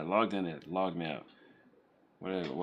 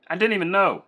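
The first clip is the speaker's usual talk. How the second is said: loud, surprised exclamation; 10 semitones higher, 10 dB louder than usual. Real, not acted. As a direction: let it burst out loud. Repeats line, loud then soft.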